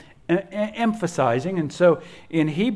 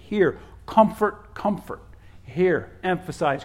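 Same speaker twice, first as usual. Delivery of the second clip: usual speaking voice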